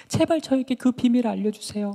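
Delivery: high-pitched nagging entreaty; acted insistent wheedling; pestering plea. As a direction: beg and badger for a secret. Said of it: "막 따라다니면서 졸랐어요".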